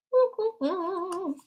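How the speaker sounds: high-pitched, sing-song chuckle; real tickled laughter; high amused laugh. Real, not acted. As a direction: giggle up high in delight. Amused at the helper card.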